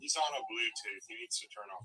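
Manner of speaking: faint caller through a Bluetooth line; muffled phone audio. Real, not acted. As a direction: sound like a pillow over the phone. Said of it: "muffled and you're, like, have a pillow over your phone".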